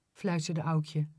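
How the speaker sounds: ordinary delivery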